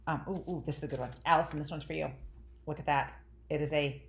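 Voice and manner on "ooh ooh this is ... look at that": normal talking voice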